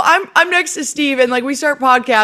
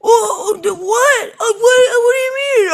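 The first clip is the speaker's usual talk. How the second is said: a caught-out husband, yelping what; flustered surprise; 11 semitones higher than usual. Retold or imitated. Husband shocked his wife refuses, high startled yelp.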